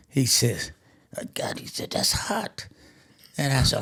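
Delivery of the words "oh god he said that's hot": a breathless whispered gasp at the heat. Retold or imitated. Friend burned by pepper, gasping whisper. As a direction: wheeze it out as if your mouth burns.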